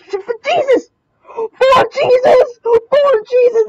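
high, drawn-out shout; sustained high-pitched yelling in the heat of play; frantic battle cry. Real, not acted. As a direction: cry it out way up high at full tilt. Frantic gamer shouting mid-fight.

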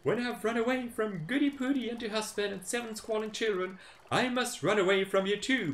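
high, taunting pancake voice; cheeky pancake. Acted. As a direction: boast while rolling away. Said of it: "said the Pancake".

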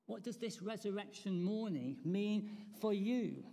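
ordinary talk, nothing marked

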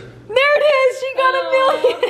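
very high, thrilled squeal; excited shriek. Real, not acted. Cheering a friend's million subscribers live, excited shriek. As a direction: shriek it out as the milestone lands.

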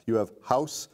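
normal talking voice

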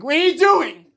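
loud, high angry shout at mom; angry yell. Retold or imitated. Son bursting in on mom, loud angry shout. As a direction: yell it in anger. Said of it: "You know, I'm fucking angry".

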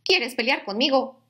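grouchy ladybug. Acted. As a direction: taunt it like a cranky ladybug. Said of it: "le dijo la mariquita".

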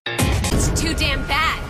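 loud, drawn-out taunt; loud, vicious gloating; menacing outburst. Acted. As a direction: burst out slowly at full volume. Cruel threat to kill.

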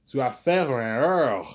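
slow French "Tu as fait une erreur"; mock French scolding. Coworkers scold her in French, slow and loud. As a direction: tell her off slowly in French. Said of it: "Tell her in French".

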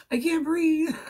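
retelling her own squeaky panic on the phone to mom; squeaky panicked voice. Retold or imitated. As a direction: squeak it out like you can't get air. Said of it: "all squeaky in my voice".

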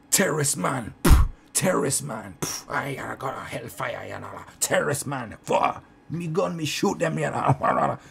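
hammed-up Jamaican accent, spat out loudly; ranting Jamaican woman. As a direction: imitate a crazed Jamaican woman shouting. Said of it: "old Jamaican bird, batshit crazy".

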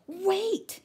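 a sudden high, loud wail on the word. A narrator's reading. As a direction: Wombat cries out in alarm, high and loud. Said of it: "she yelled, ye-, wailed".

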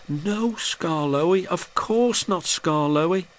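high, meek little voices; meek quaver. A narrator's reading. Coaches giving in to the old engine, high meek quaver. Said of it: "quavered the coaches meekly".

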